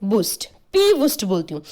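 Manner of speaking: बूस्ट said with a clear b; corrected mnemonic. Shown as a demonstration. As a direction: repair व to बूस्ट, then P बूस्ट. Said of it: "sorry, व".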